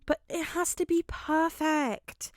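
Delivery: slow drawn-out whine; whining. A narrator's reading. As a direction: whine it into a pillow. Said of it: "he whines".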